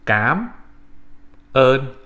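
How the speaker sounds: "cám ơn" with a hard c and rising cám; teacher models "cám ơn" for learners; Vietnamese cám ơn. Shown as a demonstration. Teacher shows how to say "cám ơn" in Vietnamese. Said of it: "it's pronounced".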